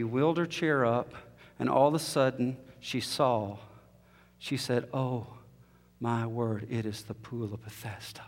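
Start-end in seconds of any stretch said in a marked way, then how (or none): 4.9-8.2 much softer, breathless wonder; awed hush. Retold or imitated. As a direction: let it fall away in stunned awe. Woman stunned seeing the pool.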